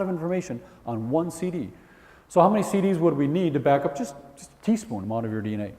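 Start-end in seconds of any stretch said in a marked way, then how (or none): none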